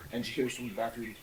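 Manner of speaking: usual speaking voice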